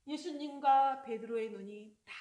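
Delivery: normal talking voice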